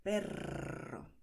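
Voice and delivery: "Perro" with a breathy trilled RR; rolled R. Shown as a demonstration. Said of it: "volvemos a tener la vibración". ("Perro" con vibración fuerte)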